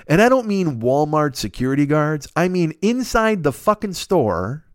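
ordinary talk, nothing marked

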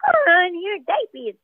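put-on squeaky toddler line in the booth; a voice actress showing her baby character. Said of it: "I was doing, you know".

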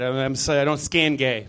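usual speaking voice

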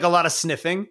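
normal talking voice

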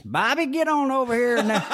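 a high-pitched Southern coach voice. Retold or imitated. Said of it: "one of those high-pitched Southerners".

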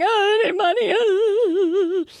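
high whiny imitation of her younger self crying; hammed-up tearful plea; mock crying. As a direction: whine it like a sobbing kid asking mom for cash. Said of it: "I was crying".

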